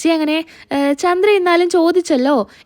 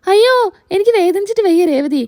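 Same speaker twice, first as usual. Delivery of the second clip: raised-pitch yelp of "ayyo"; mock wail of pain; acted pain; 5 semitones higher than usual. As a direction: cry out as if being trodden on. Husband yelps as wife stands on him.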